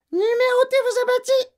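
loud, very high parrot squawk; husky parrot. A narrator's reading. Hidden parrot making the family jump, husky squawk. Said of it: "voilée et rauque cette fois".

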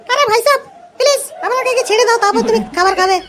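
high, coaxing put-on woman's voice; wife's voice. Wife pleading with the hungry compounder, high coaxing woman's voice. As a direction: sweetly beg to be let through first.

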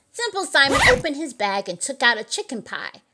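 ordinary delivery

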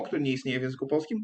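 normal talking voice

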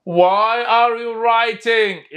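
very slow, stretched; teacher modelling slowly; slow model reading. Shown as a demonstration. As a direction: say it slowly for the class. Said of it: "عيد وياي".

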